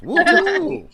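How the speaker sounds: a high, loud party whoo-hoo; loud whoop. Real, not acted. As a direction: whoop to get the crowd going. Host whooping for noise.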